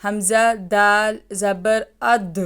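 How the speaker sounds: اد with the دال bounced and echoed at the end; teacher sounding اد with a clear qalqala bounce; qalqala demo. Shown as a demonstration. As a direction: drill اد slowly, letting the دال rebound. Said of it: "یوں پڑھیے گا".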